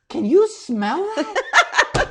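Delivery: very slow, high, drawn-out question; suspicious asking. Retold or imitated. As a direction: stretch it out in wary disbelief. Bar patron notices a stench.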